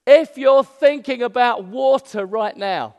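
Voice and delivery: loud, high, slow proclamation. Acted. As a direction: proclaim it loudly and slowly to everyone. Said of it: "said in a loud voice".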